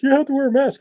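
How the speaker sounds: mock high-pitched scolding from staff; store staff objecting. Store worker challenging a masked shopper, high mock objection.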